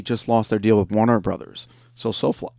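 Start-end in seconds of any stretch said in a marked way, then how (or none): 1.9-2.5 talking through hiccups. Real, not acted. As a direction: speak while hiccuping. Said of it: "I have to hiccups".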